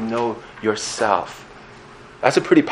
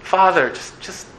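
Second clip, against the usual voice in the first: put-on childlike prayer; 7 semitones higher, 3 dB quieter than usual. Child coming to God in prayer.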